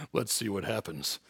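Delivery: very low, soft and noncommittal; mock coy suspense. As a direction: murmur it low, pretending to leave them hanging. Mimics Jesus withholding an answer.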